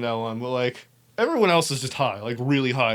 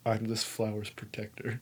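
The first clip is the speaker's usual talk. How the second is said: much softer and lower, grave oath; solemn hushed vow; 5 semitones lower, 13 dB quieter than usual. Retold or imitated. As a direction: murmur it like a sacred pledge. High, solemnly vowing to guard flower.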